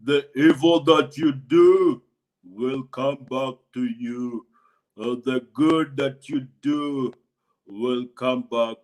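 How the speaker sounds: low, slow, loud opening warning; recalled solemn hunchback voice; hunchback man's words. A narrator's reading. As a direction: boom it like a prophecy. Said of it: "remembered the words of the hunchback man".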